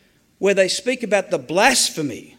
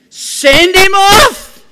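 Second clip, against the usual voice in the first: drawn-out roar, much higher and louder; furious shout at the TV; 13 semitones higher, 13 dB louder, much slower than usual. Retold or imitated. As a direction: bellow it at the referee on screen. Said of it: "I have been known to shout at the television".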